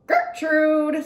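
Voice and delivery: high, loud squawking parrot cry; irritating parrot scream. A narrator's reading. Parrot screeching its owner's name at the shop. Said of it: "someone screamed in a real irritating voice".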